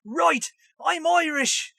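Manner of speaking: slow, high stage-Irish; exaggerated Irish accent. Host showing how people overdo accents, exaggerated Irish. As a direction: stretch out a hammed-up Irish accent. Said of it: "it often gets exaggerated".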